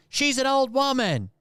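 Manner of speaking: high-pitched call from afar; retold shout. Someone from somewhere defending the driver, shouting. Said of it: "someone from somewhere shouts".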